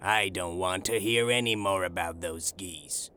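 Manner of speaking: low-pitched, angry telling-off; angry shopkeeper. A narrator's reading. Angry shopkeeper refusing the little man's questions about geese, low-pitched. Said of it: "Breckenridge shouted".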